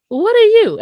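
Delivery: high, loud retort; acted incredulous question. Daughter asks security guard what he is, mock incredulous. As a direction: throw it back at him, voice leaping up.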